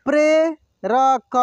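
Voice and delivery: प्रेरक drawn out slowly, syllable by syllable; slow sounding out. Shown as a demonstration. Teacher slowly sounds out प्रेरक for students. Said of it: "एक एक मात्रा को अलग अलग करके".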